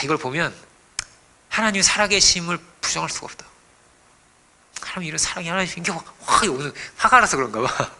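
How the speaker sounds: ordinary delivery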